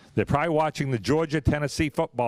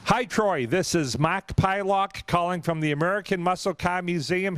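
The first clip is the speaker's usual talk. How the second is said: higher-pitched announcer delivery; prize announcement voicemail; 5 semitones higher than usual. Real, not acted. Host phoning the raffle winner with news, higher-pitched announcing voice.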